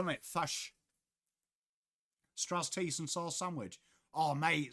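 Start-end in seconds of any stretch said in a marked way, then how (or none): 0.2-0.7 Kiwi vowel on "Fush", fish said as fush; Kiwi accent. Retold or imitated. Streamer imitates the Kiwi accent of fish, Kiwi vowel. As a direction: say "Fush" like a New Zealander. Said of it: "The Kiwi accent's beautiful".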